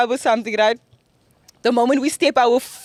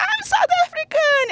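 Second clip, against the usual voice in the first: mock squealed answer, much higher; excited high squeal. She replays her answer to the club stranger, squealing. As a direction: squeal it out in delight. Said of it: "I'm like".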